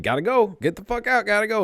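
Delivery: high-pitched, insistent eviction; imitated guard ordering. Security guard ejecting shoplifters from the mall, mock insistence. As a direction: mimic a guard shooing someone out.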